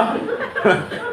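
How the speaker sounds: short airy laugh with no voice; a little breathless chuckle; soft airy chuckle; breathy laugh. Real, not acted. Laughs again.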